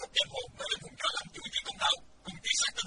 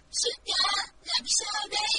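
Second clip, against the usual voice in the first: loud tearful plea; crying; 4 semitones lower, 7 dB louder, slower than usual. A narrator's reading. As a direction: cry out helplessly through tears. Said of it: "Thích Phương khóc nói". (vừa khóc vừa kêu)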